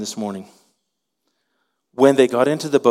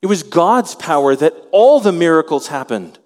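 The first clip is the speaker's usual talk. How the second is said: ordinary delivery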